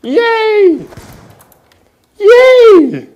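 high squealing yay; shrill cheering. Real, not acted. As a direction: squeal with over-the-top glee. Gamer mock cheering at screen.

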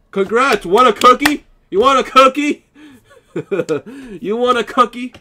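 high, loud mocking taunt; sarcastic taunt. Real, not acted. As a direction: sneer at the winner, offering a cookie.

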